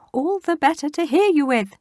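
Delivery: high, loud granny impression; wolf pretends to be grandma; fake granny voice.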